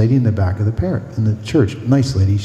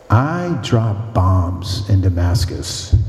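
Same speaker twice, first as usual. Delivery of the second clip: slow punchline; 4 semitones lower, much slower than usual. Real, not acted. Punchline of a funny story.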